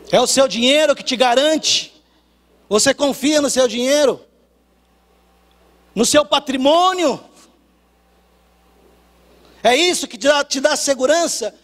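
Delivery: loud, high, challenging sermon delivery; preaching at full force. Acted. Preacher challenging the church about money, forceful preaching. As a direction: hammer the questions at the crowd.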